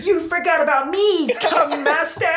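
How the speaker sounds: very high cartoon hero voice; squeaky, put-on triumphant entrance; Spyro. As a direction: pipe up like a small cartoon creature. Voicing Spyro's sudden arrival.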